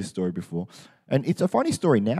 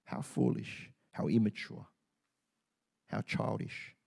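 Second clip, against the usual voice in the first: near whisper; 3 semitones lower, 9 dB quieter than usual. Acted. Self-rebuke after complaining to God.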